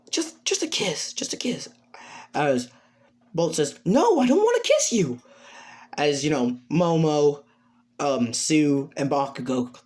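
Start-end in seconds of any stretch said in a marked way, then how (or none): 0.1-1.7 high, soft, wheedling whisper; acted hushed begging; whispered pleading. Character coaxing friend for a kiss. 3.8-5.2 high-pitched, protesting no; acted indignant protest; exasperated refusal.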